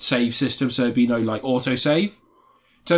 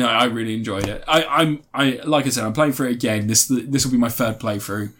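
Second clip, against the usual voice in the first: ordinary talk, nothing marked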